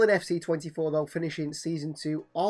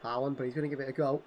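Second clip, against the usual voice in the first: ordinary delivery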